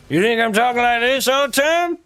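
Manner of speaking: high, loud American cowboy voice; American accent. Acted. Australian gamer mocking those fooled, exaggerated American accent. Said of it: "that's my American accent".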